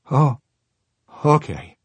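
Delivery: put-on thick Swedish accent, high and loud; a thick Swedish accent, rattled. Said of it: "in that crazy Swedish accent of his".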